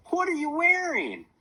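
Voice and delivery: high, loud, drawn-out disbelief; incredulous. A narrator's reading. Child gawking at Mom's workout outfit, incredulous. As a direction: stretch the question out in high-pitched amazement.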